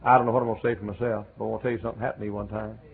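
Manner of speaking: fast, tumbling answer; eager Paul. Retold or imitated. Preacher voices Paul hurrying into his testimony, fast. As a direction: rush past the question to the story. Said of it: "And Paul said".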